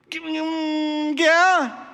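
high, drawn-out hum, then a yes; Eve's reply. Retold or imitated. Eve answering the serpent, high hesitant hum. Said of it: "And Eve's like".

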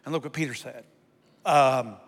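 ordinary delivery

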